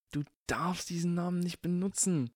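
high, tense warning; urgent hiss. A narrator's reading. Ron hisses at Harry not to use the name. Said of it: "zischte Ron".

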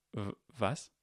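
stammered, puzzled question; utterly confused. Acted. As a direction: stumble into a baffled what. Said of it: "sagte Harry vollkommen verwirrt".

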